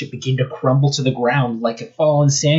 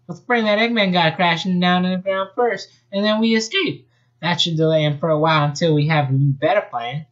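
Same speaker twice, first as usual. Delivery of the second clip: high-pitched Bunny voice; Bunny proposing a plan, high voice; 7 semitones higher than usual. Acted. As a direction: voice Bunny higher. Bunny's voice higher.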